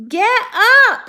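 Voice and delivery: very high, loud scream. Acted. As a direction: scream at him to move. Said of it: "screamed Wilbur".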